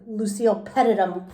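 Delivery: plain reading voice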